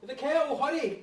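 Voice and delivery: high, loud hurried call; urgent call. Acted.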